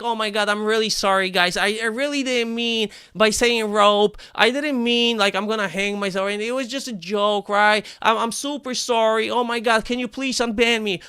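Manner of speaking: fake-beg the mods to unban you, slowed on the excuses; mock grovelling apology. Streamer mocking an apology to mods, hammed-up grovelling. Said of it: "and I cowered to them".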